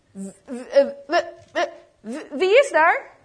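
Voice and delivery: put-on startled old man stutters in fright; frightened stammer. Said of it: "riep hij". (geschrokken stotteren, eindigend in een luidere roep)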